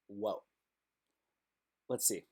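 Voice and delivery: ordinary talk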